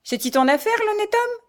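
loud question leaping high at the end; scornful rebuff. A narrator's reading. Mother mocks her son for interfering, scornful. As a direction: sneer it as a challenge.

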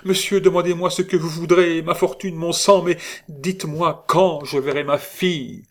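high, overjoyed, urgent pleading; a mother's high, agitated outburst; trembling voice. A narrator's reading. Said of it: "elle ajouta d'une voix tremblante".

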